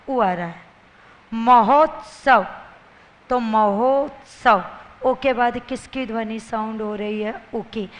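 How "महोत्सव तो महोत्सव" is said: महोत्सव drawn out slowly, louder; syllable by syllable. Shown as a demonstration. Teacher sounding out महोत्सव for students, slow and louder. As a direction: split महोत्सव into slow pieces. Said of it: "शब्द बोलिए टुकड़े करके".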